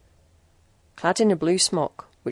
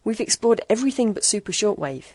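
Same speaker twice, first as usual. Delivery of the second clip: usual speaking voice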